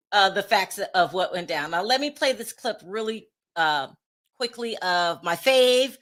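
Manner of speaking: usual speaking voice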